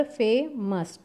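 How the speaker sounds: Famous broken as फे, pause, मस; split in two parts. Shown as a demonstration. Teacher showing students a longer word, famous split into two parts. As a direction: say famous in two separate syllables. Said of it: "दो parts में करके इसको बोलेंगे".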